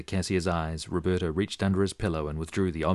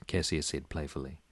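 usual speaking voice

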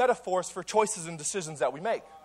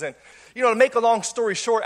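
ordinary talk

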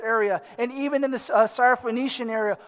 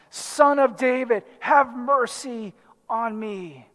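raised, drawn-out plea for mercy; crying out; slower than usual. Acted. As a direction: call out insistently, slowing on the last words. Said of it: "All the more he cries out".

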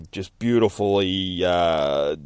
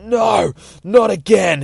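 high, loud cry of refusal; desperate shout; 11 semitones higher, 8 dB louder, slower than usual. Acted. Nightwing catching the falling judge, desperate high shout. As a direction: shout it as you grab someone falling. Hero grabs the falling villain.

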